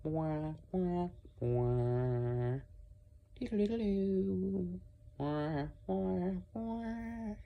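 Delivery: high, sustained mock-western wails; imitated western theme. Shown as a demonstration. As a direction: sing out a showdown theme by mouth.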